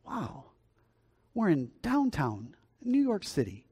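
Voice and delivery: slow, high, swooping disbelief; incredulous amazement. Acted. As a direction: marvel slowly as the astonished businessman. Amazed businessman voiced in story.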